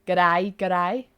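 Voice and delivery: low, loud Australian greeting; mock Australian accent. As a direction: put on a bad Aussie accent, pitched low. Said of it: "I do have an Australian follower".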